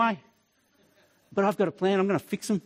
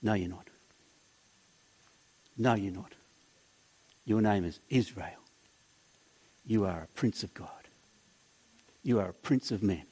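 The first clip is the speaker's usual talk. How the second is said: much lower, soft, reassuring; tender God; 11 semitones lower, 6 dB quieter than usual. Pretend. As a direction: gently lift him up as a loving father. God answers Jacob tenderly.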